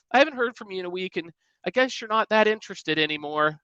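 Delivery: high, whiny mock of a needy texter; cringey mock voicemail. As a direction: make the passive-aggressive note sound pathetic. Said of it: "the cringe".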